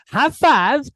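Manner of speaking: high, loud, gleeful high five; mock cheer. Referee beside the league boss cheering the bad decision, mock gleeful. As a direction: ham up a smug referee celebrating. Howard Webb imagined celebrating.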